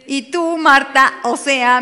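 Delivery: high, loud mock reproach; loud, acted disbelief; exasperated scolding. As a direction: confront a pupil who forgot the lessons.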